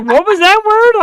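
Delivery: high, slow, puzzled southern waitress; confused waitress. Retold or imitated. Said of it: "She's like".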